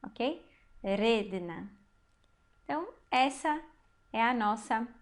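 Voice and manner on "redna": Redna with a strong, drawn-out first syllable RE; low, careful Redna, leaning on the RE; stress on RE. Shown as a demonstration. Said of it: "a sílaba forte aqui é o re".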